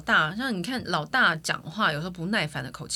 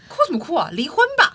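loud, high mock scolding as a little kid; bossy child; 10 semitones higher, 10 dB louder than usual. Little girl tells her crying mother, loud and high: get divorced. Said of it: "我走进去就说".

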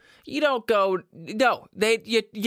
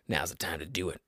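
much lower and softer, almost under the breath; hushed aside; 11 dB quieter, faster than usual. Real, not acted. Podcaster slipping a pitch to listeners, hushed. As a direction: drop it into a low, quiet murmur. Low aside, then projected repeat.

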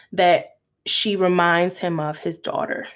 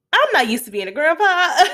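higher, louder, through a laugh; laughing quote; 8 semitones higher, 4 dB louder than usual. Retold or imitated. Retelling the grandpa's reaction while laughing. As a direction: laugh your way through the line.

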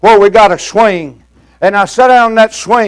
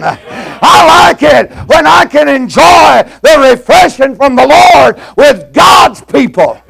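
fiery preaching; 5 semitones higher than usual. Acted. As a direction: drive it home to the congregation. Preaching at full force.

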